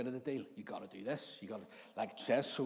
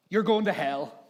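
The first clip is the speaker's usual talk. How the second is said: loud, raised-pitch damning verdict; mock condemnation; 5 semitones higher, 12 dB louder, slower than usual. Condemning an annoyed stranger, loud mock verdict. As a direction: hurl it as a hammed-up judgement.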